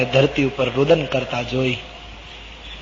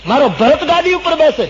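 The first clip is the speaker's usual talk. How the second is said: high, loud rapture; storyteller's overjoyed Ram, voice soaring; joyful exclamation; 11 semitones higher, 7 dB louder than usual. Acted. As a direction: proclaim it with delight. Ram rejoices at his brother's enthronement.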